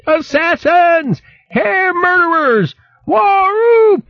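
very high, slow outraged wail; shrieking hare. Acted. Hare forced to drink potion.

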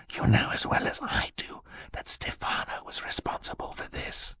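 very soft, breath-only whisper. A narrator's reading. Sister warning her brother about Stefano, whispered. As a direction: keep it secret, all in a whisper. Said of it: "Violet whispered".

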